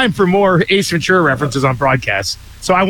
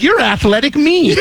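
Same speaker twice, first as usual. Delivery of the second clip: mock excited realization; 9 semitones higher than usual. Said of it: "I'm like".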